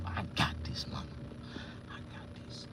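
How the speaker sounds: breathy whisper brushing mama off; hushed I got this to mama; whispered reply. Retold or imitated. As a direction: whisper it, dismissive. Brushing off mama's worry.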